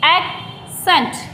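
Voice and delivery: एक्सेंट very slowly as "ek... sent"; teacher drawing out एक्सेंट, ek, then sent; syllable demo. Shown as a demonstration. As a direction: break एक्सेंट in two with a long gap. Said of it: "इसको बोलते हैं".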